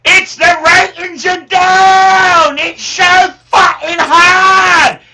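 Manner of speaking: shouted Cockney rant, high and drawn out; Cockney accent. Acted. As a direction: bellow it as an over-the-top Cockney. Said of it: "tell us in your best Cockney accent".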